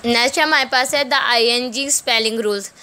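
normal talking voice

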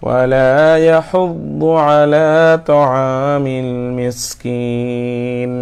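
slow, high melodic tajweed recitation; measured Quranic chant. Shown as a demonstration. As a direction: chant the verse with long drawn vowels. Teacher recites Quran verses.

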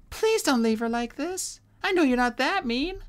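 put-on high, pleading young dragon; Sunny voice. A narrator's reading. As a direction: beg in a high small voice.

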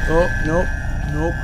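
normal talking voice

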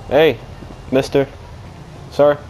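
usual speaking voice

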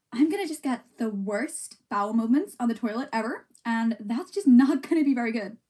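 chatty, put-on American accent; playful American girl accent. Shown as a demonstration. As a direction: ramble on in an American accent, rising at phrase ends. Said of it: "in American".

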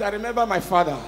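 ordinary delivery

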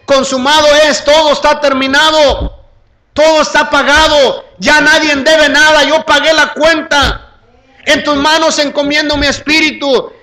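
very high, loud declarations; triumphant shouted proclamation. Acted. Preacher voicing Christ's last words from the cross, triumphant shout. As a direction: proclaim each phrase as a victory cry.

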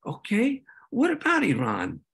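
rising Okay then a dry what about Iran, stress on about; wry echo. Retold or imitated. Speaker echoing representatives' question back, wry and amused. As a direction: throw their own line back at them, amused. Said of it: "And I'd like".

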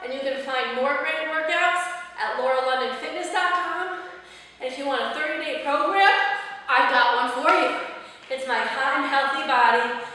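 ordinary talk

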